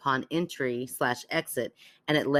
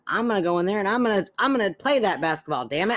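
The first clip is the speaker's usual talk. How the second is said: louder, higher mock old-timer; feisty old person; 6 semitones higher, 4 dB louder, faster than usual. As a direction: play a defiant little old person. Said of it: "like, a little old person".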